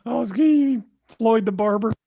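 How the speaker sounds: ordinary talk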